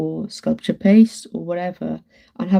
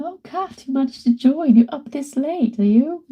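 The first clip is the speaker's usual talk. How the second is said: high, loud, surprised greeting; light up at seeing a friend online; delighted surprise; 8 semitones higher, 3 dB louder than usual. Real, not acted. Greeting Kat, who joined her live stream late, in delighted surprise.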